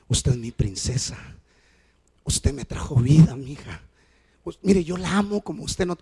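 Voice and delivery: soft, low loving words; father tenderly consoling daughter Grace. Retold or imitated. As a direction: speak gently like holding a child. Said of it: "le digo".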